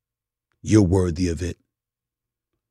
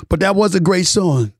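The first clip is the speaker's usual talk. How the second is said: ordinary talk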